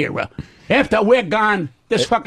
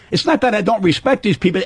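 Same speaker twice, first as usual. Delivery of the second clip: ordinary delivery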